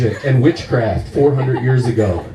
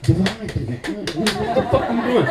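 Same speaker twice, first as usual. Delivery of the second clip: baffled; 5 semitones higher, 4 dB quieter, slower than usual. Acted. Baffled at people waiting.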